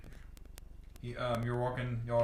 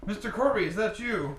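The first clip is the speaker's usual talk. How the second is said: raised, high questioning call; calling back; 10 semitones higher, 6 dB louder than usual. Acted. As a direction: hail someone you just recognized. Character calls back in surprise.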